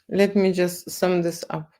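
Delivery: usual speaking voice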